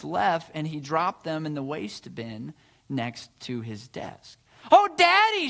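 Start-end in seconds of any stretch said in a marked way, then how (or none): 4.7-5.5 high, loud cry of protest; distressed cry. A narrator's reading. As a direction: cry out to Daddy in dismay. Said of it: "she cried".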